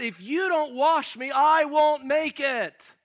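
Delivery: high, loud, drawn-out plea; crying out. Acted. Sinner begs God for washing or he won't make it, crying out. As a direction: plead at full voice, stretching the words. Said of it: "He's crying out".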